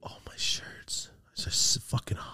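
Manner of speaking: slow, whispered answer. Real, not acted. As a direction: whisper it, almost to yourself.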